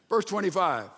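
ordinary talk

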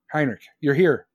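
normal talking voice

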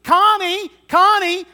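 calling the name out high and loud, over and over; yelling out. Retold or imitated. Lost little boy calls for his mom in the store, yelling. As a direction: holler for her across the store. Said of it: "He starts yelling out".

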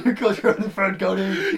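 hammed-up dying accusation; mock agony. Melting friend accusing Cody, mock agony. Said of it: "horrible pain".

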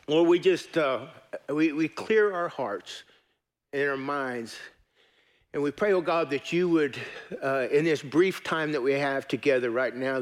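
prayer. Acted. Pastor leads a prayer.

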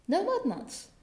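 put-on high West Country child's voice; child mud person. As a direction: echo Mum as an astonished country child. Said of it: "child mud person talking to her mum".

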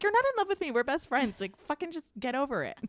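high, pushy mock friend voice; exasperated best friend. Best friend snapping at her to get over it, mock exasperated. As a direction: brush her off like an annoyed buddy. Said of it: "she's like".

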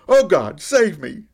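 loud, desperate cry for help; screamed out. A narrator's reading. Sinking disciple screams to God, desperate and loud. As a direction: scream for rescue, high and loud. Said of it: "All I could do was scream out".

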